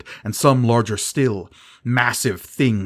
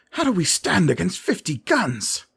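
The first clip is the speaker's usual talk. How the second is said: much higher, panicky question; alarmed; 9 semitones higher than usual. A narrator's reading. Davus asks Gerard in panic. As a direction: plead in fear.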